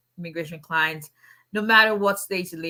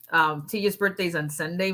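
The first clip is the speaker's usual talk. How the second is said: normal talking voice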